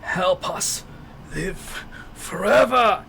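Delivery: high, slow breathy monster whisper; whispering undead creature. A narrator's reading. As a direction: rasp it out like a dying ghoul. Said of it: "it speaks".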